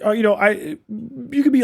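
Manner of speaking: normal talking voice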